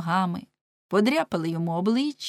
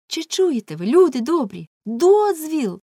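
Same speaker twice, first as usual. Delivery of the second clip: higher, louder outraged cry; indignant shout; 7 semitones higher, 6 dB louder than usual. Acted. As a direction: fling the word дозвіл back at them. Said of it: "крикнув Леншпігель". (обурений крик до людей)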